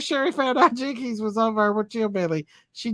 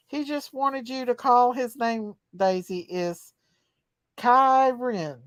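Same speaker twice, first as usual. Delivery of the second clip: usual speaking voice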